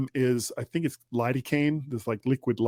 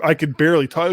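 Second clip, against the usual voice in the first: normal talking voice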